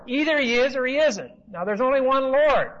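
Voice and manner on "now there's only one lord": usual speaking voice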